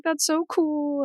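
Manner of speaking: very high, slow cooing enthusiasm; imitated delight. Wife imitating her husband's family hearing news, delighted. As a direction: gush it in a light, high voice.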